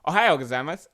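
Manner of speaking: loud, brisk Japanese greeting with a Spanish lilt; Spanish-sounding Japanese. Retold or imitated. Mocking own attempt at the greeting, Spanish-sounding Japanese. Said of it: "it's like fucking Spanish".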